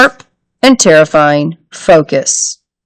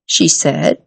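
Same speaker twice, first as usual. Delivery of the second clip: usual speaking voice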